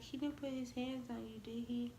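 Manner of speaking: quietly checking she is safe; a hint of hushed worry; worried hushed mom. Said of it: "And she like".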